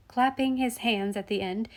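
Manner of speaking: ordinary delivery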